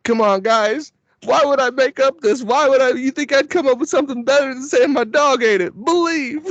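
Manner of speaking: high, hammed-up begging; begging the mob guys to believe the dog ate the money, high and hammed-up; pleading with the mob. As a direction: plead your innocence, half laughing. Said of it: "I would straight up also say".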